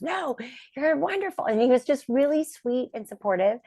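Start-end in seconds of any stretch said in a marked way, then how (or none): 0.0-1.5 high, reassuring insistence; imitating Johnny. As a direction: lift it high, kindly insisting. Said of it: "He goes".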